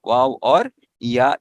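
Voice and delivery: वाव और या spelled out slowly, each letter name held; name each letter slowly for the class; slow listing. Shown as a demonstration. Teacher slowly listing the weak letters for students. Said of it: "तो अलिफ".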